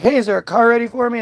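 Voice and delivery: high breezy entitled ask; cocky. Pretend. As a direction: act like a star expecting a ride. Cocky star asking for car.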